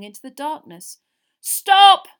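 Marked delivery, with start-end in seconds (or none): 1.4-2.1 very loud, high shout; desperate yell. A narrator's reading. Father halting the wizards' attack, desperate yell. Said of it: "yelled a voice he recognised".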